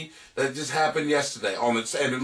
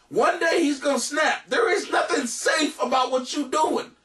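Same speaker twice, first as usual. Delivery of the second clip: shouted warning; 9 semitones higher than usual. Real, not acted. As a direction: yell the warning right at him. Warning the letter writer.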